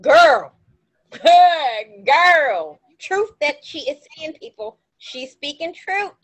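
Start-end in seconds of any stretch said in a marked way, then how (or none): 0.0-2.8 loud affirming call. Real, not acted. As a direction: call out in agreement. Affirming friend loudly.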